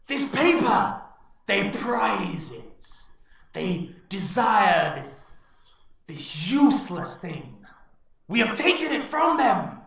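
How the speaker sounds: high, loud, slow vow of revenge; snarling orc warlord. Acted. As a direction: snarl it with menace. Garrosh brandishes paper.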